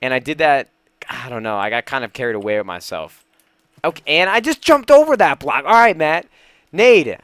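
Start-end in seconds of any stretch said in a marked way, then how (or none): 3.8-7.2 higher, loud exasperated exclamation; frustrated outburst. Real, not acted. Gamer overshoots a block, loud frustrated outburst. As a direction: blurt it out loudly, annoyed at yourself.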